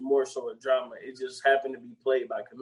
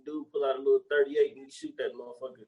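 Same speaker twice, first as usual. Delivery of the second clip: ordinary delivery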